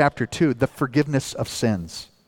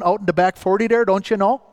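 lightly put-on northern Minnesotan accent, pitched up; 5 semitones higher, faster than usual. Preacher imitating hometown talk in northern Minnesotan accent. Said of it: "speaking with that northern Minnesotan accent".

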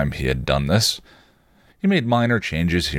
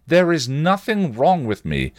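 higher, sharp angry retort; snapped angrily; 11 semitones higher than usual. A narrator's reading. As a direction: snap it back in anger. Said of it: "she snapped angrily".

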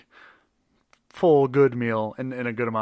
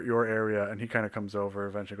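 ordinary delivery

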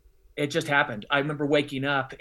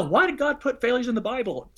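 mocking questioners; 9 semitones higher than usual. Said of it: "people always go".

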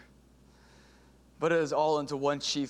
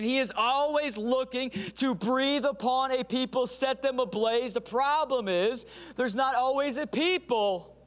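preaching at full force; 8 semitones higher than usual. Acted. Pastor urging the church toward revival, impassioned preaching. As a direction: preach it, rising to a cry.

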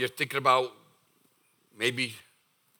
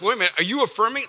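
alarmed question; 6 semitones higher, 3 dB louder, much faster than usual. Retold or imitated. Alarmed congregant's quick question.

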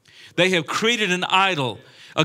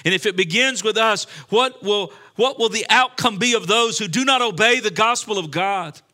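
higher, emphatic scripture declamation; forceful preaching; 6 semitones higher, 5 dB louder than usual. Real, not acted. Preacher quotes verse on judgment beginning with us, forceful preaching. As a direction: drive the verse home to the congregation.

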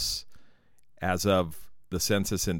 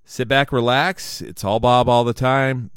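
loud, high announcer welcome; big upbeat host intro; podcast intro; 4 semitones higher, 7 dB louder than usual. Acted. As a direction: sell the show like a radio host.

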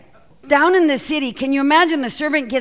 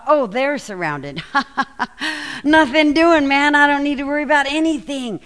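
carefree relief; 4 semitones higher, 3 dB louder than usual. Retold or imitated. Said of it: "and now he saw".

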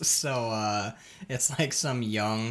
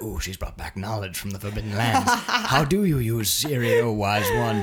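mock reverent; 3 semitones lower than usual. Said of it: "And I'm like".

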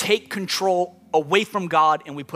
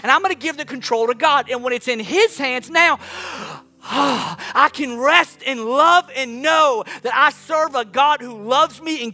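raised, urgent preaching voice; impassioned preaching; 7 semitones higher, 5 dB louder than usual. Real, not acted. Preacher urging people to hand control to God, impassioned preaching. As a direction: preach it higher, pressing on without letting up.

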